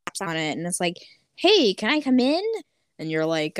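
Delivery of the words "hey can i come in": put-on higher, eager-sounding kid asking to be let in; a hint of a kid's voice, pitched up; lightly voiced kid. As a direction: pipe up like a child at the window. Voices kid knocking on window.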